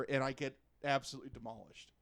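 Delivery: normal talking voice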